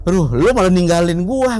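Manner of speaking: high, loud acted protest; retold indignant complaint. Acted quarrel over being left behind. (menirukan protes kesal dengan suara tinggi)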